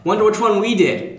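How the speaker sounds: playful knowing aside; teasing. Real, not acted. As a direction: tease them with a knowing wink.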